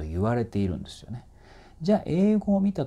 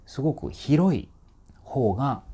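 ordinary talk

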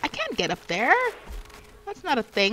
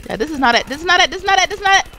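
loud repeated complaint; 5 semitones higher, 7 dB louder, much faster than usual. Real, not acted. Repeated loud complaint while losing.

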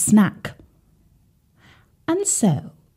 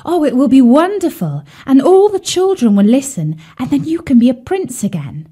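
higher, louder, eager swan voice; excited swan; 6 semitones higher, 3 dB louder than usual. Acted. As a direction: swan bursting with a wonderful idea. Sadie announces her idea.

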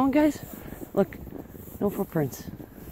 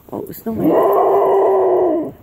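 high, slow, drawn-out oh at a snowman; startled gasp at a shape; surprised; 14 semitones higher, 11 dB louder, much slower than usual. Real, not acted.

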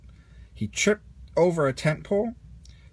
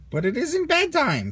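much higher, louder little dino voice; timid dinosaur voice; 13 semitones higher, 4 dB louder than usual. Acted. Toy dinosaur chiming in with friends, high timid voice. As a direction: squeak it out like a nervous toy dinosaur. Rex protests.

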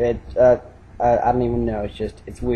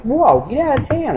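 much higher, hammed-up disbelief; mock amazement; 12 semitones higher than usual. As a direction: squeal it like you can't believe it. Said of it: "And I'm like".

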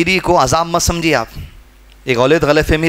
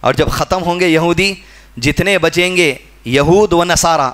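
ordinary talk

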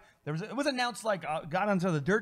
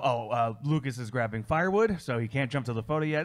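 normal talking voice

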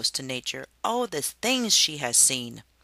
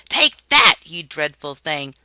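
fierce shout; 13 dB louder than usual. Acted. Harriet beating the snake.

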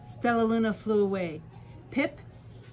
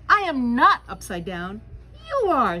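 indignant; 3 semitones higher, 8 dB louder than usual. Acted. Bat argues upside down.

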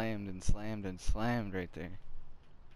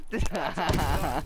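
loud excited laugh; 5 semitones higher, 6 dB louder than usual. Real, not acted. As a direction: burst of high laughter. Laughing after the miss.

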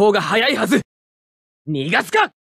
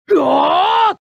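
high drawn-out attacking yell; battle roar; 6 semitones higher, much slower than usual. Acted. Pilot charging the enemy, roaring battle cry.

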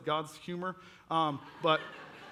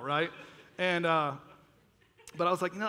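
ordinary delivery

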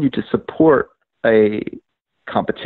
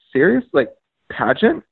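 high, questioning disbelief; retold disbelief, lifting into questions; incredulous; 5 semitones higher than usual. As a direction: imitate a baffled staffer double-checking. Retold staffer questions the objection.

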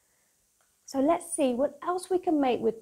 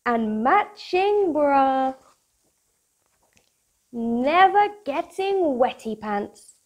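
bright, bouncy storybook read-aloud; lively picture book voice for kids; reading to children; 5 semitones higher, 8 dB louder, slower than usual. Acted. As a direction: read it like bedtime story time for little ones.

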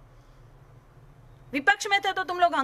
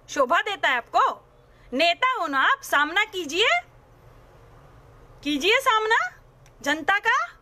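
usual speaking voice

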